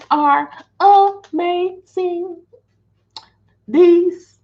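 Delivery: high, drawn-out rave; excited. Real, not acted. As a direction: gush over your favourite snack. Raving about chips.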